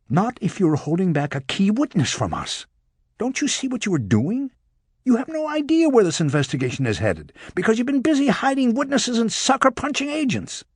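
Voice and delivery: heated, high-pitched accusation; angry. A narrator's reading. Angry agent accuses detective of holding back a witness, heated. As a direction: climb in pitch as the accusations mount. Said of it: "how angry she was".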